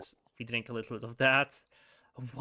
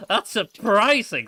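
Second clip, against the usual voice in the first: a higher, louder laugh of surprise, slowed; 9 semitones higher, 11 dB louder, slower than usual. Real, not acted. Player surprised by an Australian-accented character, laughing. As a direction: laugh out loud in disbelief.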